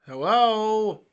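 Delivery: loud, high call of hello. Real, not acted. Frozen camera, calling out to listeners, loud. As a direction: call out to check if anyone hears.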